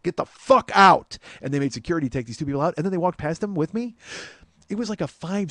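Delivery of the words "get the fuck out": higher, much louder, slow roar; raging shout. Retold or imitated. [name] ordering troublemakers out of the pit, a slow roaring shout. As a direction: bellow it like a singer losing it. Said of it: "real emotion and real rage".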